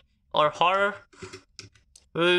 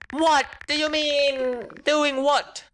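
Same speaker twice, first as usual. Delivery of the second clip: high, slowed, drawn-out mock demand; hammed-up disbelief, pitched well up; exaggerated alarm; 9 semitones higher, slower than usual. Reacts to worrying message, demanding explanation.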